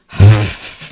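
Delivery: loud, forceful exhale; heavy sigh. Real, not acted. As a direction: let out a big stressed sigh. Relieving stress over the scene.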